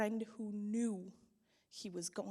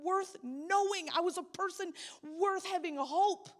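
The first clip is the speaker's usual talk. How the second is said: higher and louder, a raw cry that keeps building; anguished outcry; 10 semitones higher, 9 dB louder than usual. Real, not acted. Grieving friend crying out at God over Carl, raw louder anguish. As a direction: pour out real grief and anger to God at full voice.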